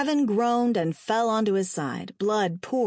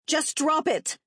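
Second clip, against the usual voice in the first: sharp higher-pitched command; urgent raised-pitch warning; barking; 8 semitones higher, slower than usual. A narrator's reading. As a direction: bark it as an order. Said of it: "Lexi barked".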